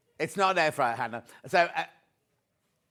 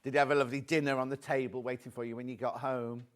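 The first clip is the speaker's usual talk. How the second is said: ordinary delivery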